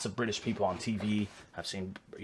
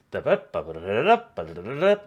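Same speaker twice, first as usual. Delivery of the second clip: sing-song da-da-da mimicking the British tune, a bit louder; playful mock British up-and-down melody; mimicking British inflections; 6 dB louder, faster than usual. Shown as a demonstration. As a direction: hum out the lilt of a British accent with nonsense syllables. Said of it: "like, the inflections".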